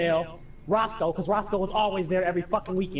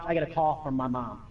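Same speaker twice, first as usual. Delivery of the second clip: ordinary talk, nothing marked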